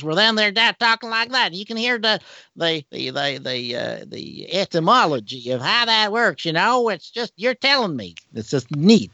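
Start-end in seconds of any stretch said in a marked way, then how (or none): 0.0-1.5 high, hammed-up Texas twang; Texas accent. Shown as a demonstration. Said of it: "all the way down to Texas". 1.5-8.1 high-pitched mock Texan talk; Texas accent. Shown as a demonstration. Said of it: "all the way down to Texas".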